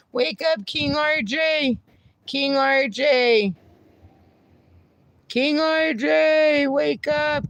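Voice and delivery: slow high pet voice; singsong coaxing. Real, not acted. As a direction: coax the dog awake sweetly. Coaxing dog to wake.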